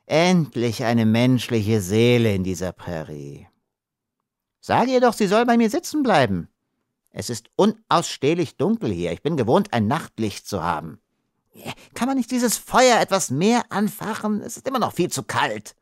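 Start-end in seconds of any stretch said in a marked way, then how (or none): 4.6-15.8 higher, complaining little creature voice; high petulant complainer; whiny little Monito. Acted. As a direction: fuss and whine like a spoiled small man.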